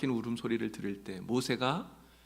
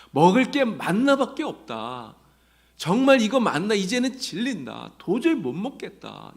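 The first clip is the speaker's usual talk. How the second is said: grumbling complaint; 7 semitones higher, 6 dB louder than usual. Retold or imitated. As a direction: act out a fed-up people complaining. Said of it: "하나님 앞에 불평합니다". (질려서 투덜대며 불평하는 목소리)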